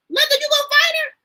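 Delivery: high, fast excited "Linda, you gonna fight her?"; excited tease. Real, not acted. Host eagerly teasing a viewer about a fight, high and fast. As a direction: egg her on, thrilled and squeaky.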